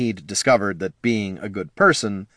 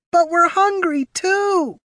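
whining pup; slower than usual. A narrator's reading. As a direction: complain like a hungry pup. Another pup complains.

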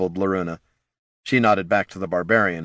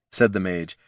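ordinary delivery, nothing marked

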